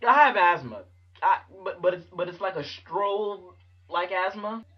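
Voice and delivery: acted near-tears. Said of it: "sound like you're about to cry".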